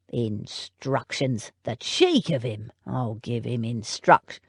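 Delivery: low, slow, scornful outrage; offended at the cheek of it; a hint of an outraged footman; indignant footman. William indignant.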